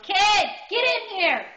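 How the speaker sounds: put-on loud, high, stretched-out call to the kids; acted angry summons, slow and loud; mad mom yell. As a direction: holler for the kids like an angry mother. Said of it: "and I am mad".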